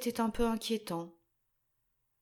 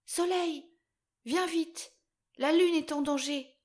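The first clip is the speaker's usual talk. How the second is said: high, louder urgent call; a child's high, loud call for help; 7 semitones higher, 5 dB louder than usual. Acted. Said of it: "l'appela à la rescousse".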